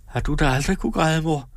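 put-on girl taken aback, asking her mother; a daughter surprised her mother never cried; surprised question. A narrator's reading. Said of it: "spurgte Karen forundret".

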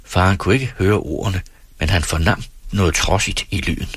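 ordinary delivery, nothing marked

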